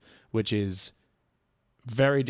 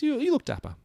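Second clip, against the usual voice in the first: high mock echo; 13 semitones higher than usual. Teasing echo of compliment.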